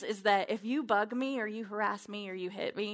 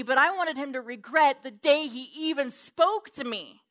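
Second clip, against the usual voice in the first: usual speaking voice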